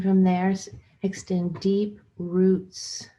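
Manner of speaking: unhurried guided meditation with long pauses. Acted. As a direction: guide listeners into their bodies phrase by phrase, leaving room between.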